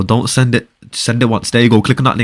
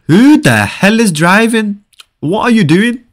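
high, drawn-out outburst at the driver; exasperated scolding; 8 semitones higher, 3 dB louder, slower than usual. Real, not acted. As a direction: demand an explanation in a raised pitch.

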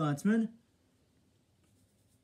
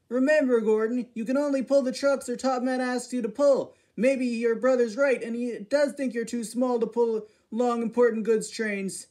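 louder, higher lecturing voice; scolding engine; 6 semitones higher, 6 dB louder than usual. Acted. James lecturing Gordon about Sir Top Mat, loud and higher. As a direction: set him straight, loudly.

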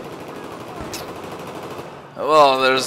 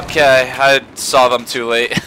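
ordinary talk

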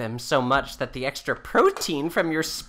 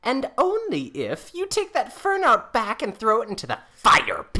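stern demand; 7 semitones higher than usual. Acted. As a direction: lay down the law to him. Wife lays down her terms.

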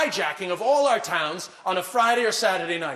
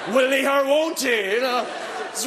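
suspense; 5 semitones higher than usual. Pretend. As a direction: drag it out like a game show. Mock suspense over a pint.

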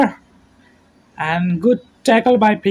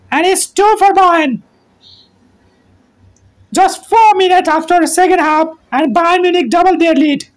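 goal call; 7 semitones higher, 3 dB louder than usual. Real, not acted. Commentator announcing Bayern's second goal, excited shouting. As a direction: stretch out the scorers' names in triumph.

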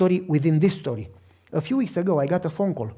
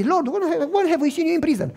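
surprised question; 9 semitones higher than usual. Retold or imitated. People questioning the Lord.

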